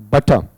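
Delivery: "बटर" as buh-tuh, r left silent; "बटर" British style, no r sound at the end; silent final R. Shown as a demonstration. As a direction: say "बटर" ending on an open schwa. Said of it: "इसका उच्चारण बेटा इस तरीके से जाएगा".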